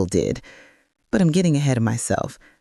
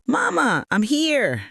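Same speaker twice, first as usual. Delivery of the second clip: son calling mama from the door, a little higher; 10 semitones higher, slower than usual. Acted. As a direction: call out cheerfully on arrival. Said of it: "he called out".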